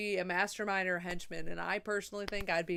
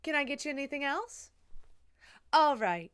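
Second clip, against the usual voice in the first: lilting, higher barista voice; Starbucks customer-service voice; 6 semitones higher, 4 dB louder than usual. Shown as a demonstration. Barista to a drive-thru customer, customer-service voice. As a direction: replay the put-on drive-thru politeness. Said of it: "Who the fuck was that?".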